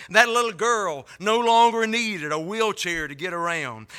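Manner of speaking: usual speaking voice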